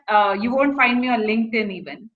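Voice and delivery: usual speaking voice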